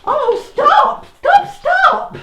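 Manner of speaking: high, loud panicked cry; shouting stop. Real, not acted. [name] shouts to stop them carrying Audrey, alarmed. Stopping them lifting the body.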